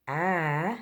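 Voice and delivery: "A" as in apple, flat and bright; short a sound. Shown as a demonstration. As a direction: pull the lips wide on "A". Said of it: "we hear the difference".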